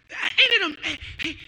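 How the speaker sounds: usual speaking voice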